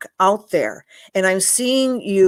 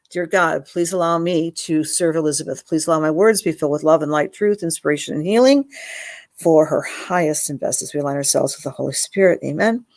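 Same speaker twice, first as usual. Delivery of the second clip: prayer; 4 semitones lower, faster than usual. Acted. Prayer over the sitter.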